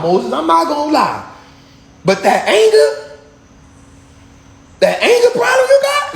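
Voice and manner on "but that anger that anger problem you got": much higher, slowed, pointed question; rising indignation. Retold or imitated. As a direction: press the accusation upward. Preacher confronting Moses as God.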